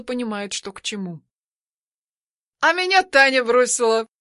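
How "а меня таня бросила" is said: laughing loud like a fool; drunken laugh. Acted. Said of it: "Ржу я как дебил".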